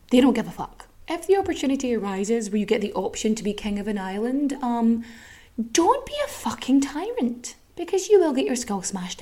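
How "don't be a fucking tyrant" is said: usual speaking voice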